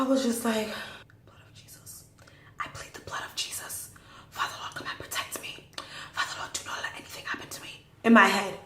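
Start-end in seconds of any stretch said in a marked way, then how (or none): 1.3-7.8 urgent prayer under the breath; whispered prayer. Retold or imitated. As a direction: whisper it as a silent plea. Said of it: "I'm just praying to Jesus".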